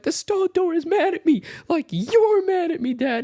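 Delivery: high, wailing mock sob with laughter breaking through; mock crying. Crying to dad on the phone about the angry stall door.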